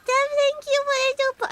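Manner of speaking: a high chipmunk thank-you; squeaky voice. Acted. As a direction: thank him in a squeaky cartoon voice.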